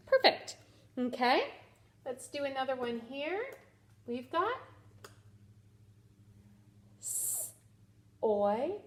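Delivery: usual speaking voice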